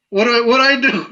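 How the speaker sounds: high, flustered voice of someone caught off guard; confused prank victim. Retold or imitated. Mocking people fooled by the prank, high flustered confusion. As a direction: play the startled person who has no idea what to do. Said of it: "people are like".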